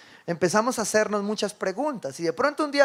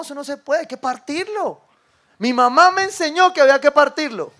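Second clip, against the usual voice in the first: higher, louder, insisting; insistent wife; 8 semitones higher, 9 dB louder than usual. Retold or imitated. Wife insists to her husband that the fish must be cut, loud and insistent. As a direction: put your foot down like a stubborn wife.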